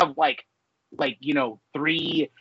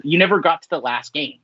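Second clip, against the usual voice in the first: normal talking voice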